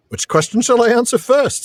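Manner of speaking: Pippin. Acted. Reads Pippin answering the lad.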